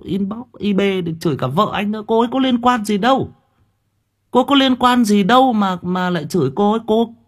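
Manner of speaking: high, heated protest; real indignation. Real, not acted. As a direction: protest indignantly, pitch pushed way up. Phẫn nộ vì vợ bị chửi. (giọng phẫn nộ, bức xúc, lên cao)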